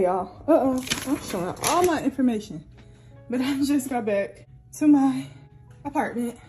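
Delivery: ordinary delivery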